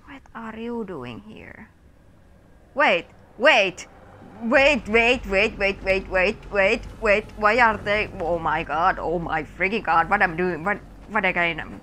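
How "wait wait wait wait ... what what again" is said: loud, rapid pleading wait wait; frantic combat panic, loud and urgent; panicked shouting. Real, not acted. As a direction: yell in a frantic scramble.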